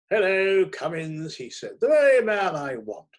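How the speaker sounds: loud, high, hearty hello; buoyant greeting. A narrator's reading. Barnaby greets the narrator on the stairs, loud and buoyant. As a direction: boom it out like a jolly editor.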